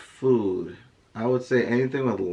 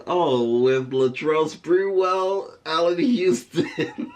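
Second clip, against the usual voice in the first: higher, slow, dreamy mock swoon with a laugh; imitating wife's swooning; 7 semitones higher, 3 dB louder, much slower than usual. Husband mimicking wife gushing over old Knicks players, slow and high. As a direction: tease her by drawing the names out lovingly. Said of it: "she says".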